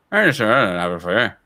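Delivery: mock a Glaswegian neighbour mumbling fast Scottish; retold neighbour, thick Scottish accent; dense Scottish accent. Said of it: "densest Scottish accent".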